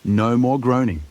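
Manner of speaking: plain reading voice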